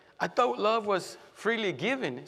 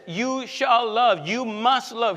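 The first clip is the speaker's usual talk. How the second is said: slow, loud, commanding decree; firm command; 5 semitones higher, 7 dB louder, slower than usual. Acted. Preacher voicing Jesus' command to love, slow and loud. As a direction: lay it down as an order, slowly. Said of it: "Jesus says it in this tone".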